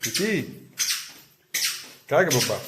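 put-on high, coaxing baby talk to a cat; pet talk. Owner coaxing the cat Mau, high baby talk.

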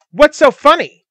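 high, loud, angry challenge; sharp, offended demand; irritated snap. A narrator's reading. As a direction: snap it out, voice jumping up. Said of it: "Manuel snapped".

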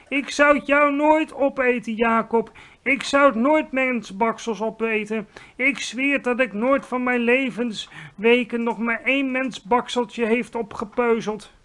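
high, loud, desperate promises; screeching. A narrator's reading. Giant swears to Jacob he will never eat humans, high screeching. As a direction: swear it at the top of your voice, pleading. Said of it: "krijste hij".

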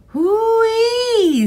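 high, loud, long whoop; screamed holler. A narrator's reading. Man in a speeding Jeep hollering at the children, screaming. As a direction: let out a piercing whoop. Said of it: "somebody screamed".

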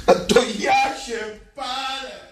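the doll realizes it is burning; high, fragile panic of a doll. Acted.

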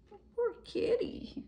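a gasp, then high and slow; real shocked pity for the cat; gasping sympathy. Real, not acted. Reader gasps at the picture of the cat, high and pitying. Reacting to mug thrown at cat.

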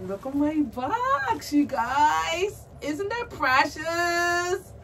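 high, slow gushing coo. Real, not acted. Showing viewers her precious box, gushing. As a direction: coo over it with drawn-out words.

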